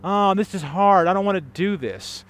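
hammed-up whining moan; mock whining. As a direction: whine it like a sulking churchgoer. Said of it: "we complain and we whine".

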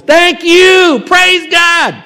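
shouted high, joyful thanks; loud praise. Retold or imitated. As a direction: cry out praise at full voice. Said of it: "with a loud voice".

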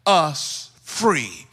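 fervent sermon with punched key words; impassioned preaching. Real, not acted. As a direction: preach it with full, rising conviction. Preaching at full force.

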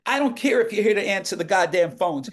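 high-pitched Southern bluster; Carl Killingsworth. Retold or imitated. Southern man overrules the page answering phones, imitated Southern accent. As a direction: imitate a brash Southern boss. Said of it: "that's how Carl talked".

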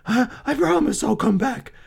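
high, stammering promise; frightened man promising the monster; full of dread. A narrator's reading. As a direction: give your word in a high, fearful voice. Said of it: "heavy with dread".

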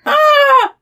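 very high squeal of a laugh; the trickster laughing; mock gleeful shriek. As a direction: let out a sudden high shriek.

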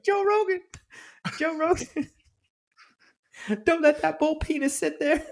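very high, squealing mock panic; Chappelle impression. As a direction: squeal it in comic alarm. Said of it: "He's like".

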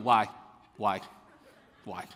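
short authoritative questions, each standing alone; mock a small boy demanding answers with authority; assertive child. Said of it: "with quite the authority and assertiveness".

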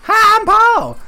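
high goofy greeting; silly voice. Pretend. Friend echoing the mock intro, high put-on voice.

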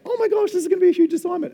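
very high, fast, loud squeal; hammed-up high-pitched freak-out; mock panic. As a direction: blurt it out in comic alarm. Acted panic at discovering huge assignment.

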